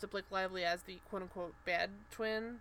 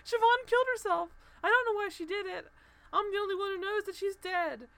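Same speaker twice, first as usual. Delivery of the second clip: 夸张地模仿哭诉, 声音明显更高更响; 假装崩溃地一口气说出来; mock crying; 10 semitones higher, 7 dB louder than usual. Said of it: "she's crying on the balcony".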